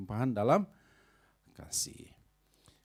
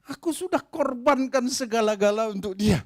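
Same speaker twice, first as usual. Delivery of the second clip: aggrieved complaint; 8 semitones higher, 10 dB louder than usual. Acted.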